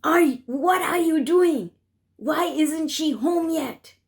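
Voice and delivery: retelling her father shouting at her at midnight; angry shout. Retold or imitated. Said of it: "he shouted at me".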